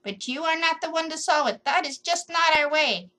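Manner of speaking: put-on a stubborn old tree scolding; scratchy tree voice. A narrator's reading. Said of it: "a scratchy kind of voice say".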